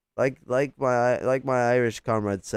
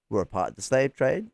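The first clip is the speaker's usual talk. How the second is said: normal talking voice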